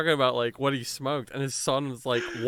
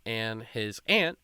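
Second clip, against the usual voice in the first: ordinary talk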